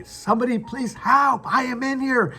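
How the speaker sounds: top-of-the-lungs style call for rescue; screaming for help. Acted. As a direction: cry out as if trapped and needing to be heard. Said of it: "I would scream up the top of".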